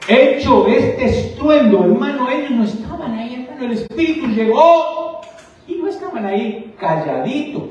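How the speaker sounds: loud, high, impassioned preacher; shouting sermon climax; fiery preaching. Acted. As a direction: preach it with full force. Preaching at full force.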